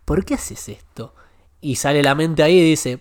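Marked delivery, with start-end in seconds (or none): 0.0-1.1 slow, softer questioning inner voice; a quiet voice in his head asking why. Retold or imitated. His conscience asking why he keeps playing, soft and slow. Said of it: "Y me vino esa voz y una pregunta fue".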